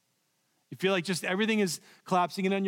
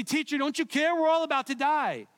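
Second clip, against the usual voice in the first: panicked disciples; 9 semitones higher than usual. Acted. Disciples panic in sinking boat.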